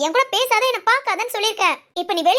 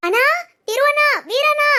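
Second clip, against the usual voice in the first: high, urgent call; raised-pitch alarm call; calling for help; 7 semitones higher, 4 dB louder than usual. Acted. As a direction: summon the men from another room. Calls household men for help.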